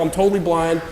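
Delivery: ordinary talk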